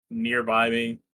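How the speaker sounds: normal talking voice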